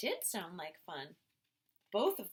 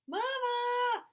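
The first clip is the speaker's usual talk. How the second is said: much higher, louder yell; shout for Mama; 8 dB louder than usual. A narrator's reading. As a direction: yell for Mom in a panic. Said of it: "Mothie shouted".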